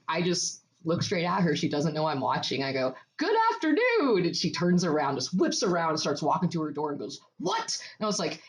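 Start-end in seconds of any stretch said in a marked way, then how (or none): 3.2-4.2 high, pointed call-out greeting; mock-cheery call. Woman retelling how she startled her neighbor, high call-out. 7.4-7.8 high, rising retort; mock startled, pitched way up; imitating startled neighbor. Woman imitating her caught-out neighbor, high startled question.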